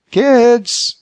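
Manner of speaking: high, loud mother's call; sustained, high-pitched summons; calling loudly. A narrator's reading. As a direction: call the children from another room. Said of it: "his wife called loudly".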